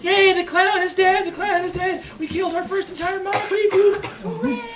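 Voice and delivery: tiny high, soft cheering voice; confetti cheer. Acted. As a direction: celebrate in a small, squeaky hush. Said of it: "Waving his confetti, like".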